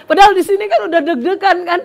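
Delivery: high, loud, laughing as she admits her panic; nervous laughter. Real, not acted. Preacher telling the congregation her heart was pounding, loud laughing. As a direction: laugh out the tension.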